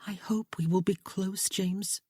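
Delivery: soft breathy whisper, voice dropped away; whispered hope. A narrator's reading. Bride whispering her hope to James, hushed. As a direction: breathe it to him like a secret wish.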